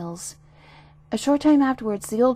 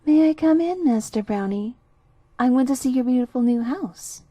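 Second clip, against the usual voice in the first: gentle feigned voice; 4 semitones higher, 7 dB louder than usual. A narrator's reading. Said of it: "a gentle voice said".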